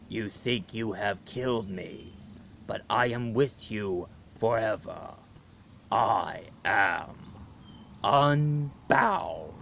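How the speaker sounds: low, soft, slow menacing monster; monster's voice from afar. Retold or imitated. Monster's unseen voice threatens Buchanan, low and slow. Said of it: "the voice of the monster says".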